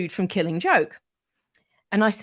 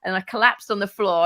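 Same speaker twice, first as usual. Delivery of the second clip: ordinary talk, nothing marked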